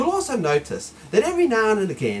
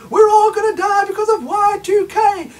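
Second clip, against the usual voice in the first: high, loud panicky shout; hammed-up doom shout; mock panic; 12 semitones higher, 7 dB louder than usual. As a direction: wail it like the sky is falling. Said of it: "Then it was".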